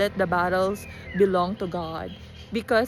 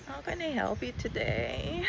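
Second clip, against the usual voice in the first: sleepy morning voice; groggy, just out of bed; just woke up; 3 semitones higher, 7 dB quieter than usual. Real, not acted. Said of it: "Sorry, I just woke up".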